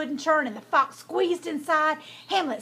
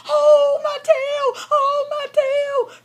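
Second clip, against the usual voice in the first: high, loud drawn-out wail; crying out in pain; 14 semitones higher, 9 dB louder, much slower than usual. A narrator's reading. Fox's tail is caught in the door, wailing. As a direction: howl in pain as the trapped fox. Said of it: "cried mean, tricky old Doodle Mouth Fox".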